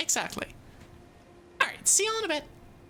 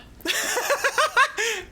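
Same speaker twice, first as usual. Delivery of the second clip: high, loud burst of laughter; bursting out in high giggles; real laugh; 9 semitones higher, 5 dB louder than usual. Real, not acted. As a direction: crack up at your own confession. Laughs at own admission.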